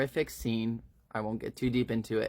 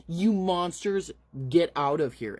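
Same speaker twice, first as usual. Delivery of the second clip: screaming at them; 6 semitones higher than usual. Acted. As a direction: drive the monsters off, yelling high. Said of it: "She's screaming".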